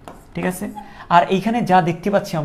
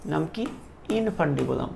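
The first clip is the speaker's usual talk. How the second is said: normal talking voice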